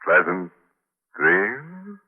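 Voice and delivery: drawn-out, teasing goodnight with the last word rising; sinister host sign-off. Acted. Horror host bidding listeners goodnight, teasing and sinister. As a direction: wish them pleasant dreams with a wicked wink.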